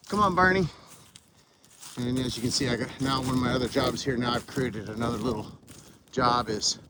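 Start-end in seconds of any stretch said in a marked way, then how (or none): 0.1-0.7 calling a dog, loud and high. Real, not acted. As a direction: call the dog from across the yard.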